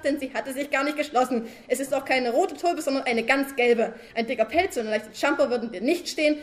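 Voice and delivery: sustained loud tirade, fed up at last; loud rant. Acted. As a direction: keep shouting him down, no letting up. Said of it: "schrie Balduin Brumsel". (anhaltende laute Schimpftirade)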